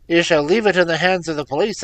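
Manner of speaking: father threatens his son with the police; enraged cry. A narrator's reading. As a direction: threaten him with the police in fury. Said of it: "I cried, half mad with grief and rage".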